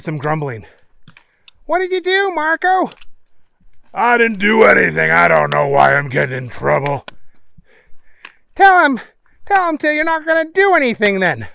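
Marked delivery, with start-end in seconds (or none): 1.6-2.9 very high-pitched comic villain; high henchman voice. A narrator's reading. Bad guy questioning Marco after the boss calls, high henchman voice. 3.9-7.1 loud, lightly voiced bad-guy grumble; protesting henchman. A narrator's reading. Marco denying he caused trouble, loud protesting henchman. 8.5-11.5 loud, very high, insistent; high henchman voice. A narrator's reading. Bad guy egging Marco on, loud high henchman voice.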